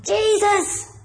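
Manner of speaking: a mock cry to make him stop; retold shout. Bartimaeus shouting to Jesus over the crowd, mild mock cry. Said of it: "he shouts all the more".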